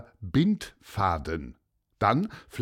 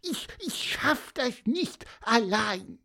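high, frustrated little bird voice; squeaky; 11 semitones higher, 3 dB quieter than usual. A narrator's reading. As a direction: chirp in frustration, straining. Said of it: "piepste er".

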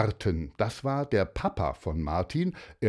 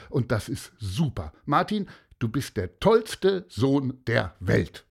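emphatic praise, pitched up; proud father; 5 semitones higher, 4 dB louder than usual. Acted. Father telling Martin he is the greatest son, emphatically proud. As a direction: praise him with full conviction.

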